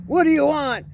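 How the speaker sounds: forceful, gruff mock-man demand. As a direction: imitate a man's voice to scare someone off. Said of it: "gruff voice".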